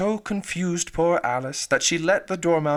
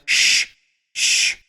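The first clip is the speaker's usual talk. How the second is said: sharp loud hushing; loud shush; 7 dB louder than usual. Acted. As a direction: hush them hard.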